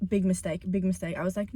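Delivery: usual speaking voice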